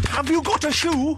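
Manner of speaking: high-pitched, daft crank voice; mock lunatic. Imagining a nut job's questions, mock lunatic voice. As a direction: ask it like a baffled oddball, up high. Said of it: "someone who types like that".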